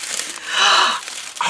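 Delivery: sharp high gasp of surprise; delighted gasp. Real, not acted. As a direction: gasp at the sight of the gift. Opening a surprise gift stocking.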